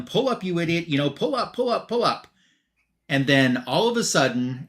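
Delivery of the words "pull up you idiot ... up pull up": higher-pitched mock yell at normal volume; urgent pretend shout, climbing higher at the end; retold mock yell. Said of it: "And Luther's yelling".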